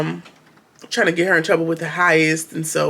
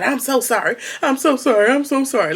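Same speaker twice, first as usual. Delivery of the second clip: mock pleading; 6 semitones higher than usual. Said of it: "in full submission talking about".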